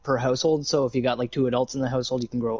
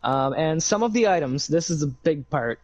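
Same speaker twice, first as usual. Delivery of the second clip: normal talking voice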